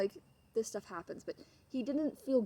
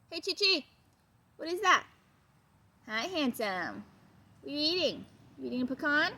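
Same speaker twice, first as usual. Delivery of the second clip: high, loud pet-talk; 8 semitones higher, 5 dB louder than usual. Real, not acted. Trainer calling to her Chihuahua in high pet-talk. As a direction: coo at the little dog. Owner interrupted by her dog.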